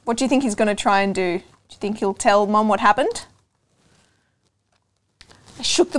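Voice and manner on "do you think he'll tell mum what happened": ordinary delivery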